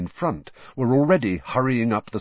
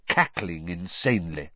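usual speaking voice

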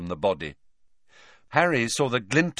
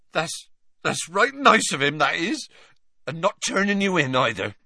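emotional Hagrid, voice higher; moved half-giant, rambling; touched Hagrid; 5 semitones higher than usual. Acted. Hagrid thanks Slughorn in his absence, touched and higher.

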